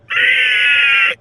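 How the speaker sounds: piercing high creature shriek; creature squeal. Acted. Clump the critter reacting to the giggling bunnies, shrill squeal. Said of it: "Clump just looks at you and just goes".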